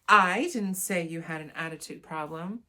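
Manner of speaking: "I" hit loud at the front; stress on I. Shown as a demonstration. Showing how meaning changes, stress on "I". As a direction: punch the word "I", then carry on. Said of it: "emphasizing a different word".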